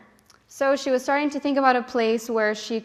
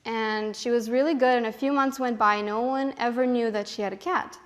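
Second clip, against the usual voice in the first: usual speaking voice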